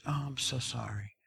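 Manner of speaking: very soft retold apology; imitating a meek, hushed sorry; quiet apology. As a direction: murmur the apology, barely audible. Tearful waitress apologizing to him.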